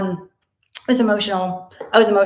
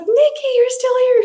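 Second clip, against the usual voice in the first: much higher and louder, squealing; delighted surprise; 9 dB louder than usual. Retold or imitated. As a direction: greet the old nurse in shock. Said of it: "And I was like".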